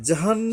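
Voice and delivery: high, loud জাহান with a held n; nasal ghunnah. Shown as a demonstration. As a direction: hum on the n of জাহান. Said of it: "গুণনা করতে হবে". (জাহান গুন্নাহ করে)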